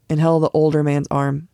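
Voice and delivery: plain reading voice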